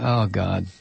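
normal talking voice

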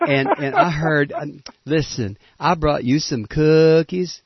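drawn-out Southern cookie-bringer impression; put-on Southern accent. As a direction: imitate her Southern accent, stretching the words. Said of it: "she put on that southern accent".

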